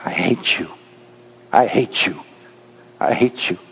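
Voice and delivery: quiet echo dying away; echoing back. A narrator's reading. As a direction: let the hate come back hollow and fading. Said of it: "reverberated back".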